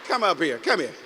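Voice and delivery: calling someone up. Real, not acted. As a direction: call him up to the stage. Calling audience member up to stage.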